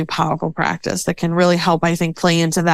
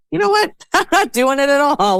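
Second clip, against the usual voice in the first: much higher, tossing off a playful refusal; defiant quip; 8 semitones higher than usual. Retold or imitated. To herself, dropping the chores for good, playful defiance. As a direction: shrug off the chores like a cheeky kid. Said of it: "Or I can say".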